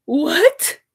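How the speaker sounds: loud, high crying shout; anguished, high-pitched outburst; tearful outrage. Real, not acted. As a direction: shout in disbelief through real sobs. Outraged reply, breaking into tears.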